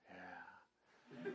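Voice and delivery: very soft, low "yeah"; hushed child's reply. Retold or imitated. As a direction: barely let the answer out. Boy's hesitant reply, retold.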